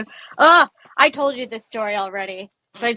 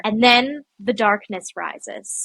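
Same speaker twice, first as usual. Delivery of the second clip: ominous slow narration; 2 semitones lower, much slower than usual. Acted. Dramatic storytelling.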